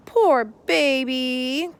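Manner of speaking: put-on slow baby-talk coo. A narrator's reading. Girl comforts a nervous turtle, slow tender coo. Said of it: "cooed Sunny".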